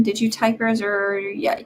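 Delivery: ordinary delivery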